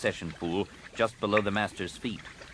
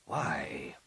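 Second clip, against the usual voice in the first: whisper; 4 semitones lower, 5 dB quieter than usual. Acted. Master presses apprentice secretly.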